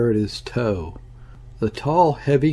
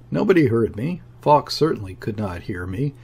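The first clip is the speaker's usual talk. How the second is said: ordinary delivery, nothing marked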